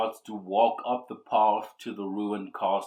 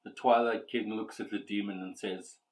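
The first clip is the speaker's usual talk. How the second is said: ordinary talk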